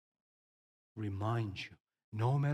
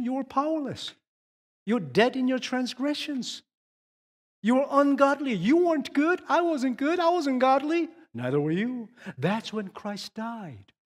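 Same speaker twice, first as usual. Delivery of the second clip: much higher and louder, driving each short line; impassioned preaching; 10 semitones higher, 6 dB louder than usual. Acted. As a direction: preach it with rising fervour. Preaching at full force.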